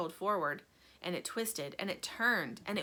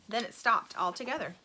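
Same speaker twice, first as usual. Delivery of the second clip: plain reading voice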